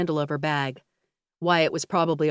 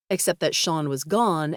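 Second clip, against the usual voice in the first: ordinary delivery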